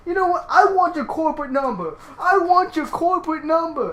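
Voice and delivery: high-pitched mock demand; hammed-up high complaining voice; mock irate customer. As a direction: whine it high, imitating an outraged customer. Mimics customer demanding number.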